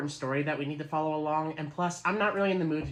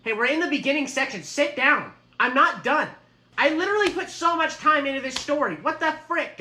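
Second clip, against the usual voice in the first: high, loud protest; upset shouting; 8 semitones higher, 6 dB louder than usual. Acted. Game master to players leaving his table, upset shouting. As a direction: call them back, loud and high-pitched.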